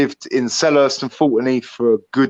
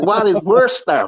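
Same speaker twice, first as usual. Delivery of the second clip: mock American; 8 semitones higher than usual. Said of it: "They're like".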